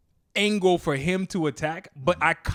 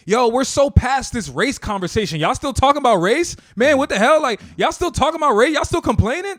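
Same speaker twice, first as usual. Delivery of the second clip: loud, high-pitched imitation of fed-up people; mock exasperation; 7 semitones higher, 6 dB louder than usual. Mimicking people tired of race conversations, exasperated. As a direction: act out incredulous complaining, voice raised.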